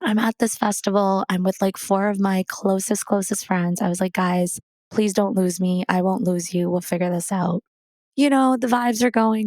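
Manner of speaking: ordinary delivery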